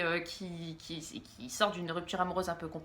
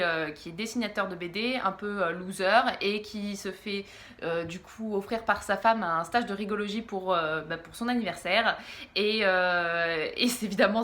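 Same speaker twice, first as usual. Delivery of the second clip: ordinary talk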